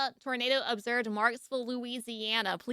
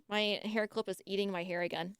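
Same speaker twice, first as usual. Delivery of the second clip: ordinary talk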